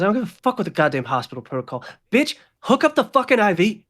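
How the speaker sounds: fast defiance rising to a loud, high order; angry demand. Acted. Gunman ordering nurse to hook up IV, angry demand. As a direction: build into a furious command.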